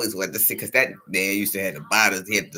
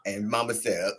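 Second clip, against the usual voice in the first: ordinary delivery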